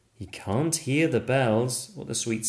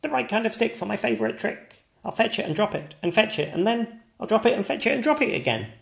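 much higher, excited dog voice; barking dog voice; 13 semitones higher, much faster than usual. Acted. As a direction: yap it out like an eager dog. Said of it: "barks the dog".

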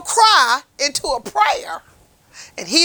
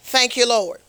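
normal talking voice

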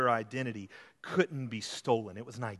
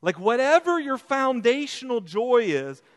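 fervent preaching; 7 semitones higher, 7 dB louder than usual. Acted. Preaching at full force.

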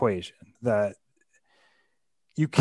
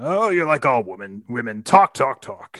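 blustering retreat; 3 semitones higher, 6 dB louder than usual. A narrator's reading. King backing down from his daughter, blustering dismissively. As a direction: scoff it while backing down. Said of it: "he just kind of backs down".

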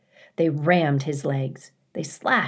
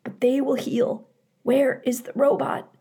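higher, strained injured mother bear; weak injured bear; 8 semitones higher than usual. Acted. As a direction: push through the pain. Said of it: "she rasped".

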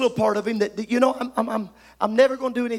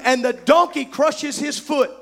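ordinary talk

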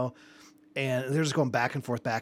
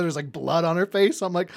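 ordinary talk

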